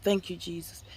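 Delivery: a hushed prayer of relief under her breath; hushed relieved thanks. Retold or imitated. Retelling the moment she felt no burning, a hushed thank you. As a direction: breathe the thanks out very soft, barely voiced. Relieved whisper after sitting up.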